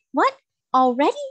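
bright, loud, high-pitched joyful cry. Acted. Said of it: "cried the girl joyfully".

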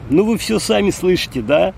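normal talking voice

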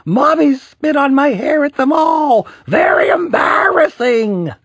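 high, loud crying shout; wailing shout. A narrator's reading. Boy shouting at the mall about the spit, wailing. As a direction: wail it like a mortified kid.

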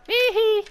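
very high "hee hee"; high gleeful giggle. Real, not acted. Player delighted at finding arrows, high gleeful giggle. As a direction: squeal a little giggle.

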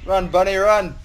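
loud, high playful shout. Real, not acted. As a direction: yell it after fleeing bunnies. Chasing bunnies away.

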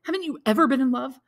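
high, loud anguished cry; a young man crying out in pain; cried out. A narrator's reading. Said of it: "cried the young man".

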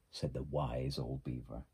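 ordinary delivery, nothing marked

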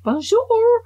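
high, loud singsong bonjour; melodious French greeting. Acted. Showing off her beautiful French, melodious and hammed-up. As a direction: trill it musically like a movie Frenchwoman. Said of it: "in a melodious voice".

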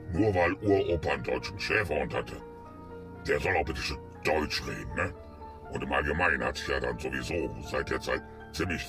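ordinary delivery

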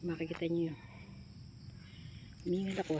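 normal talking voice

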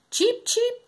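very high little chirp; tiny bird cheeping high; chirping sparrow. Acted. Sparrows excited for the ark, very high cheeping. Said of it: "chirped the sparrows".

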